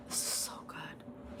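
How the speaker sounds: breathy whisper of pleasure; whispered delight. Real, not acted. Streamer whispers over her noodles in hushed delight.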